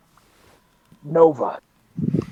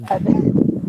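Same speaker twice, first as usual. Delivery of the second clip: ordinary talk, nothing marked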